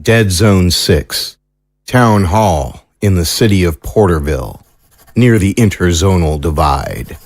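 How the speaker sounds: low, measured announcer's read; low narrator introducing a new location; scene-setting narration. A narrator's reading. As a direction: set the scene slowly in a low voice.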